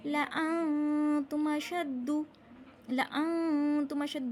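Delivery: "लअंतुम अशद्दु" with short la, long held nasal; unstretched laa. Shown as a demonstration. Teacher showing students, "लअंतुम" with unstretched la. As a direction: chant "लअंतुम अशद्दु" slowly, clipping the first la. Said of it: "ला को बिल्कुल नहीं खींचना".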